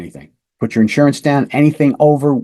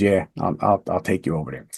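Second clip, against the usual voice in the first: defeated murmur; 5 semitones lower, 7 dB quieter than usual. Retold or imitated. Defeated suspect agrees.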